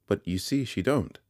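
high-voiced, indisputable dismissal; final. A narrator's reading. Girl refusing Pumblechook entry, with finality. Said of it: "She said it so finally".

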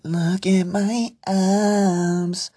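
high, drawn-out mock show-off; mocking e-boy. Podcaster mimicking TikTok boys showing off, high mocking drawl. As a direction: stretch each word in a high, mocking singsong.

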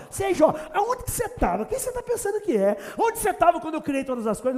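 high-pitched rebuke from God; lightly voiced stern God scolding Job; mild mock scolding. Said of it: "dá um sabão no Jó". (Deus dando um sabão, em tom agudo)

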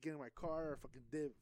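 usual speaking voice

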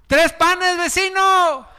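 high, sing-song begging call; pleading neighbor. Acted. Neighbor knocking at midnight, calling high and pleading.

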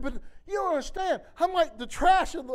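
hammed-up retelling of his own protest; mock distraught protest. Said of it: "I said".

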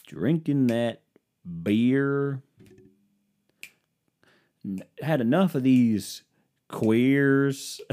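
lightly sung Southern country line; a hint of a twangy country singer; mock Southern country ballad; southern twang. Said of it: "southern twang to my voice".

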